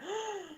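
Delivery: high, soft imitated gasp; mock amazed gasp. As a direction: gasp like an astonished friend. Imitates friends' amazed reaction.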